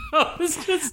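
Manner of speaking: squealing laugh breaking the words; shrieking laughter. Real, not acted. Telling how she tweeted, squealing with laughter. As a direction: laugh high and hard through it.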